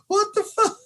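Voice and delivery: high, laughing disbelief; laughing exclamation. Real, not acted. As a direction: squeal it through a laugh. Laughing at pointless movie banter.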